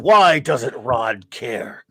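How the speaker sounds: very slow, every word drawn out; dramatic slow question. Shown as a demonstration. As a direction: drag it out like a big mystery. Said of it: "The question is, everyone".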